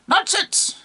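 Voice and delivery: high-pitched cheeky monkey voice; scoffing monkey. Acted. Monkey talking back to the bishop, high and scornful.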